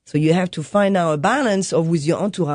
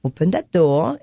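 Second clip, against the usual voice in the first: ordinary delivery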